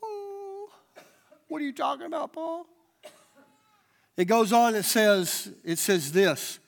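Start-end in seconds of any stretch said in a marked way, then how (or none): 0.0-2.7 mock bewildered crowd. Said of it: "And they're like".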